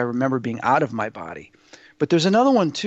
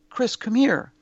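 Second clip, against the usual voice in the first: much higher, lightly voiced call; imitated beckoning call; 8 semitones higher, slower than usual. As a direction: retell beings gently summoning a child, pitched up. Beings calling the child over.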